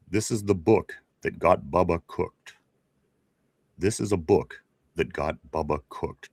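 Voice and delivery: slow, low, deep tagline delivery; James Earl Jones. Retold or imitated. Host quizzes co-host on an old commercial tagline, deep and slow. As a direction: intone it slowly like a deep-voiced commercial announcer. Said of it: "with that real deep voice".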